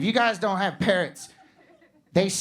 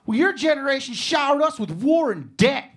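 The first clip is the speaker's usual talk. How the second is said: put-on defiant teen comeback; 8 semitones higher than usual. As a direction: fire back like an insulted kid. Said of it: "he literally goes".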